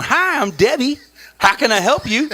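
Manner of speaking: high, chirpy phone-operator voice; imitating Debbie. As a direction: play the cheerful bank rep answering. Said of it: "and Debbie picks up the phone".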